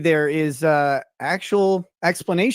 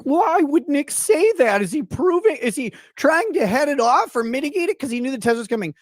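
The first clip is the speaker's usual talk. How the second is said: mocking accusers; 10 semitones higher than usual. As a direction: parody them in a high incredulous voice. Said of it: "they're like".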